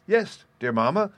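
put-on high, loud, eager little boy; eager child's cry. A narrator's reading. Little boy runs to his mother, crying out eagerly. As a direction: pipe up eagerly in a small child's voice. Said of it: "cried Peony".

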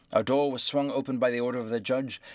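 usual speaking voice

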